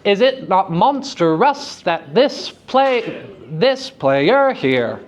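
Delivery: slow, overdone ham reading with "monstrous" in three syllables; bad actor voice. Shown as a demonstration. Teacher mocking the unelided meter in a bad actor voice. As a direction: ham it up like a bad actor, dragging each word. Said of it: "we're gonna put on our bad actor voice".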